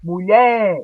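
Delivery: "Mulher" with a loud, long lher; stressed final syllable. Shown as a demonstration. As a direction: punch the second syllable of "Mulher". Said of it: "é a sílaba tônica".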